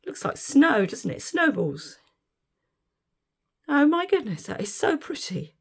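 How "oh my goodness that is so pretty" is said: ordinary talk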